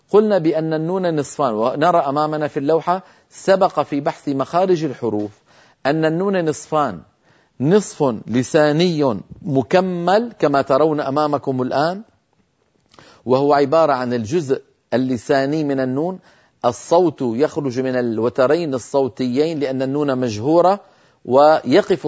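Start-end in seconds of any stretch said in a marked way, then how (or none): none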